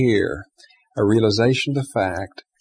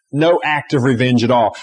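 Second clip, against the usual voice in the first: ordinary delivery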